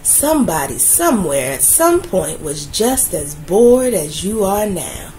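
plain reading voice

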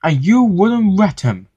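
cross. A narrator's reading. Henry blames the manager for excluding James, cross. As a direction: throw the blame back crossly. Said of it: "said Henry crossly".

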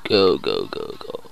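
ordinary talk

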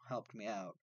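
ordinary talk, nothing marked

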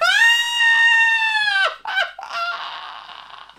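shrieking laugh; helpless laughter at the letter; high, loud burst of laughter. Real, not acted. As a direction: break into a loud, high cackle. Host laughs at listener's letter.

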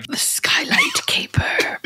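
slow dramatic whisper. Acted. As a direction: whisper the title like a mystery. Whispered spooky title.

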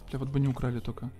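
normal talking voice

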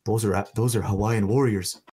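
imitating a dead-serious warning; really serious. Local warning visitors about Night Marchers, imitated seriousness. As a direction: retell it gravely, as a solemn warning. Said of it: "gets really serious".

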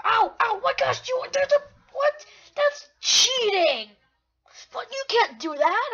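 high, loud shocked protest; outraged yelling. Real, not acted. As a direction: yell out at being cheated. Hit while typing, protests.

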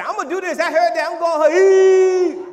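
high, loud mock shout for nothing; mock hollering. Church folks hollering for nothing, mocked as a high loud shout. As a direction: ham up a showy church holler. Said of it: "Just hollering for nothing".

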